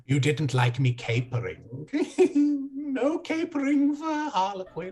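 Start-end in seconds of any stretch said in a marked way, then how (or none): 1.9-4.9 high whiny jester lament with a cackle; sulky jester. Acted. Harlequin, nailed to the wall, whines high about capering. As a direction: pout high like a pinned clown.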